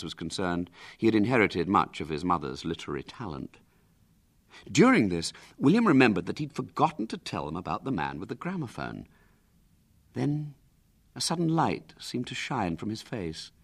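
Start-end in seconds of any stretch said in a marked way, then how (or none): none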